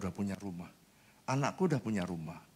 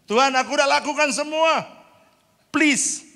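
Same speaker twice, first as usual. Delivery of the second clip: much higher and louder, pleading; desperate cry to God; 14 dB louder than usual. Retold or imitated. Pastor begs God not to shame him, high and loud. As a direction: cry out that you've done everything.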